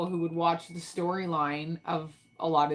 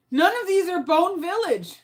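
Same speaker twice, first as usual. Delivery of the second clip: exasperated; 12 semitones higher, 5 dB louder than usual. Real, not acted. Notes keep failing her.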